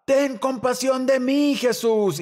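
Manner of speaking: high pleading cry; calling out. Acted. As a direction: act out a beggar calling to a passer-by. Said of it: "él está gritando mientras Jesús pasaba".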